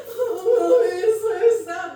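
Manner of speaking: loud, hammed-up child's wail; mock sobbing child. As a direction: wail it like a heartbroken little girl. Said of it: "her crying".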